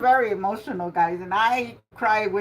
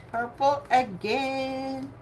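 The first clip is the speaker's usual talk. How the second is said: Purple again stretched out slowly and softly; slow, soft, deliberate repeat of the colour; drawn-out bingo call; 2 semitones higher, 4 dB quieter, much slower than usual. Shown as a demonstration. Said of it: "calling bingo numbers".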